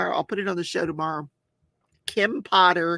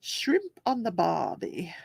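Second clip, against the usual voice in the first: put-on Australian accent; 5 dB quieter, slower than usual. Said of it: "I love that accent".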